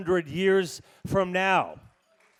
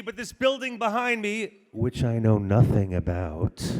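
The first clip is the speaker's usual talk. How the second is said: normal talking voice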